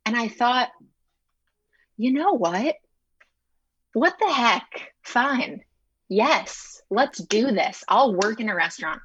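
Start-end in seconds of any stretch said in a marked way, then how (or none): 2.0-9.0 excited self-talk. Retold or imitated. Her own inner decision retold.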